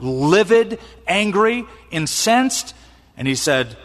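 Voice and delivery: ordinary talk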